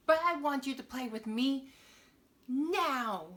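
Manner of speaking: put-on high, loud demanding child; whiny child. Annie demanding her friend play with her, whiny and loud. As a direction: demand it like a spoiled kid.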